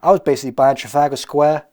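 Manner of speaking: a touch of a loud American movie hero; lightly American hero voiceover; American accent. Pretend. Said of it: "Why am I doing an American accent?".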